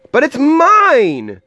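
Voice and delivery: high, loud, drawn-out protest; storybook bird's indignant scream. A narrator's reading. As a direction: wail it possessively, very high. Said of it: "screamed the bird".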